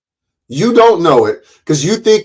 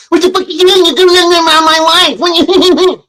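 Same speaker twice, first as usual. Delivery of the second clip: very high, squealed mock crying whine; 5 dB louder than usual. Mocking Ian for sounding like he cried, high whiny fake sobbing. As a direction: wail it in a mocking crybaby squeal. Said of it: "sounded like you were crying".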